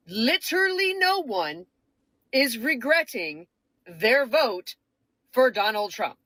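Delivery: phrase by phrase with pauses between; slow and emphatic. Real, not acted. Making it abundantly clear to you guys, slow and emphatic. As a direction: spell it out slowly, leaning on each phrase. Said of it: "make that really, really abundantly clear".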